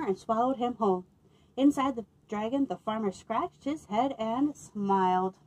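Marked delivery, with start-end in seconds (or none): none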